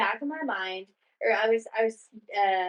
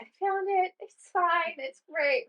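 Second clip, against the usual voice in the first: high, relieved gushing; bubbly relief after finding the ring; giddy high all-is-well; 7 semitones higher, slower than usual. Retold or imitated. Relieved retold call.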